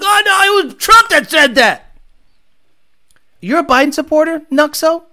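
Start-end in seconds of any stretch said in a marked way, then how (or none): 0.0-2.0 high, loud mock protest; a much higher, louder sarcastic impression of a viewer; mocking whiny voice. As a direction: play the defensive chatter in a whiny mocking voice. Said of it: "you're like".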